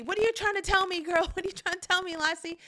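high, excited talk to the dog; Lassie owner voice. Retold or imitated. Owner pleading with Lassie the dog, high and eager. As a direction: coax the dog like in the old show. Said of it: "the dog would come out and they'd say".

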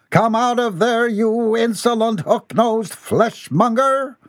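high, theatrical challenge; blustering threat. A narrator's reading. Captain threatens the thing in the bush, high blustering challenge. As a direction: stretch out the insult grandly.

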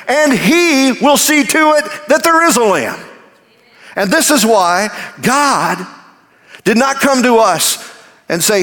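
high, rising, fervent preaching; preaching at full force. Acted. Preacher building to the climax for the congregation, fervent.

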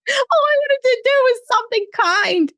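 high laughing wail; laughing cry. Real, not acted. Narrator laughing in disbelief at the failed kindness.

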